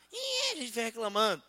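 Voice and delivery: ordinary talk, nothing marked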